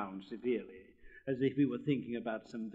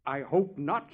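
complacent; 4 semitones higher, 9 dB louder, slower than usual. Acted. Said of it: "said the frog complacently".

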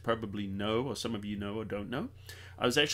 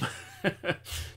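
quick laugh; 6 semitones higher than usual. Real, not acted. Joke about hills versus mountains.

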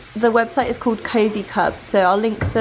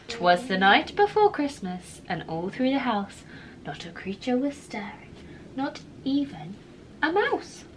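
bedtime story reading; 7 dB quieter than usual. Acted. Reading poem to child.

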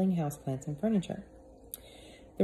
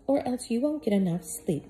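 lightly voiced housekeeper, covering fear; forced cheer; 6 semitones higher than usual. Missus Molasses lying to Peter, forced cheerful voice. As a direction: paper over the fear with brightness.

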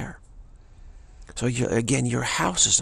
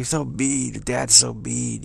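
mock whining child; 2 semitones higher, slower than usual. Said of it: "the child starts crying".

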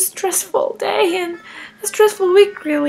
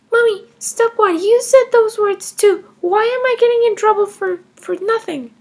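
put-on higher, defiant little girl voice; child Emma; 5 semitones higher, 4 dB louder than usual. Kindergartner Emma defending taking her mom's money, higher child voice. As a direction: play a cheeky kindergartner justifying herself.

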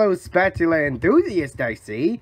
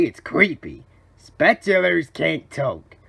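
usual speaking voice

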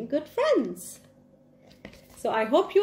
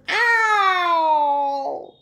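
higher, louder pained ow; lion's yelp of pain; 8 semitones higher, 7 dB louder than usual. Acted. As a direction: yelp as if bitten on the nose. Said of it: "said the lion".

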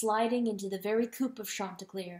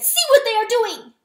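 high, loud outraged wail; grief-stricken cry; 12 semitones higher, 11 dB louder than usual. A narrator's reading. Weasel showing the dead widow, grief-stricken cry.

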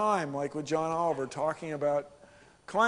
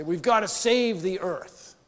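slow, louder, mock-solemn slogan; mock earnest slogan; 2 semitones higher, 4 dB louder than usual. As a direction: drag it out like a preachy activist. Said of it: "you'll hear people say".

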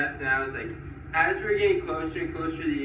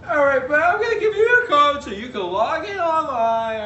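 much higher, mock feminine customer-service voice; woman-like guy; 12 semitones higher, 9 dB louder than usual. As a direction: squeak it up like the agent he took for a woman. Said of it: "this guy who I thought was a woman".

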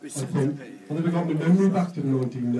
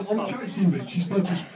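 ordinary talk, nothing marked

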